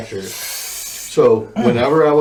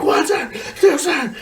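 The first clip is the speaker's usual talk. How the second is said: very high squeaked mock reply; mock strained recruit. Recruit answering as spit falls on the face, strained high squeak. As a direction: squeak it out, much higher than usual. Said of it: "and you're like".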